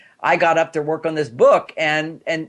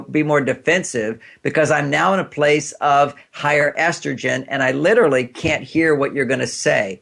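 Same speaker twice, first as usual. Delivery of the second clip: normal talking voice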